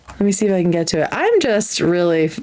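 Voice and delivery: ordinary talk, nothing marked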